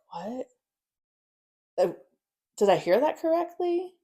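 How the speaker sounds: re-enacted bewilderment, climbing high at the end; halting, puzzled question rising sharply; confused disbelief. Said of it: "like, confused".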